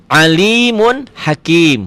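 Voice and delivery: drawn-out, chanted Arabic reading; measured tajwid recitation; slow Quran recitation. Shown as a demonstration. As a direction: recite it slowly, stretching each vowel. Teacher recites Quranic phrase.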